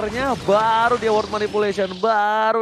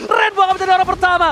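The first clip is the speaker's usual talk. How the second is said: fast excited call; 6 semitones higher, faster than usual. Real, not acted. Caster calls turret push.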